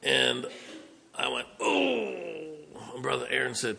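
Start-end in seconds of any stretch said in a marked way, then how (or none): 1.6-2.2 pain groan. Retold or imitated. Retold moment of pain after fall.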